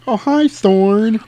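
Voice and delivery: high, drawn-out singsong hello; hammed-up sweet hello to a dog; sweet greeting. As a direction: coo it like greeting a pet.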